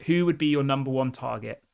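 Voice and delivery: usual speaking voice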